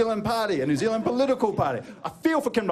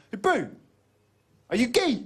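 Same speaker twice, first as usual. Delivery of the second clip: higher-pitched mock kid heckler; lightly voiced cheeky youngster jeering; cheeky young heckler; 5 semitones higher than usual. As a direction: imitate a pestering lad, pitched up. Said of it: "all these young little dicks".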